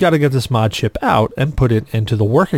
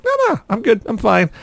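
high dismissive reassurance; 8 semitones higher than usual. Retold or imitated. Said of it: "I'm like".

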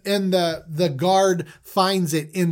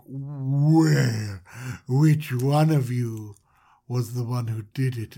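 much lower, slow menacing questioning; creepy ruler voice; 6 semitones lower, slower than usual. Retold or imitated. Joseph questioning the brothers, low and slow creepy voice. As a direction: loom over them, slow and low like a creep. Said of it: "And he's like".

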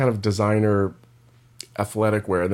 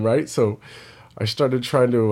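ordinary talk, nothing marked

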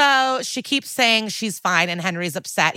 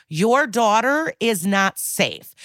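mock-patient, heavily drawn-out warning; slow and exaggerated; 3 semitones higher, slower than usual. Benson slowly letting stubborn parents know, slow and exaggerated. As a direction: spell it out very slowly, exaggerating each word. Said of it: "has to slowly and exaggeratedly let them know".

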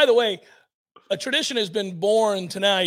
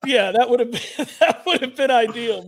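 ordinary delivery

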